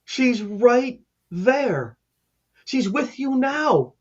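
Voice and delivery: high, slow, drawn-out insistence; retold firm plea. Caseworker to a panicking mother about her daughter, firm slow plea. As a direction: stretch each word to get through to her.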